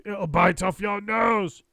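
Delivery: husband hamming a slow scare; spooky threat. A narrator's reading. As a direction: stretch it out to frighten her. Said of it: "Mister Twit said".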